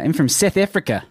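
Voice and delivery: South African accent with flattened vowels; a light South African accent. Shown as a demonstration. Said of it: "I can do South African".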